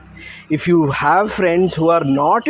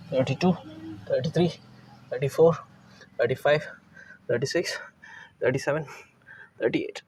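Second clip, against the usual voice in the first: counting through the strain, fading to a whisper; breathless counting mid-rep, getting quieter; 4 dB quieter than usual. Real, not acted. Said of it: "I don't know if you could hear my voice shake".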